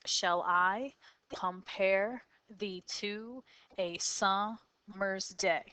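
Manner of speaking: "Shall I compare thee to a summer's day" slowly, split into two-syllable pairs; iambic feet. Shown as a demonstration. Teacher marking the five meters of the sonnet line, slow measured pairs. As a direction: pause after each pair, leaning on its second syllable. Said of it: "So you have the first one".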